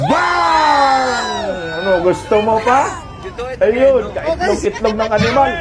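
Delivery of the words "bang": long shouted "bang" sound effect; mimicked bang. Shown as a demonstration. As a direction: stretch the bang out as it slides down. Mimics hits on the target. (mahabang sigaw na "bang")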